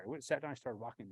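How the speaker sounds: ordinary delivery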